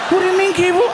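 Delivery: put-on high, loud frightened child; scared kid voice. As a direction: panic like a little boy. Kid voice panicked.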